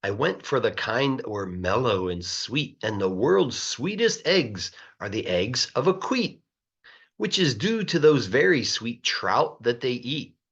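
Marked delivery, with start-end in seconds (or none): none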